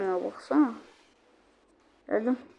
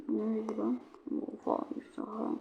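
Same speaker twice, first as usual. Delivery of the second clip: whispered under the breath; breathy whispered question; 12 dB quieter than usual. Real, not acted. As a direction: hush it like a secret aside. Whispered aside while playing.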